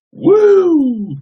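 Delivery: high, loud wrestling woo; Flair woo. Retold or imitated. Hyping up chat with a loud high woo. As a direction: let out a big high woo.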